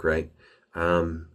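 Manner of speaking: usual speaking voice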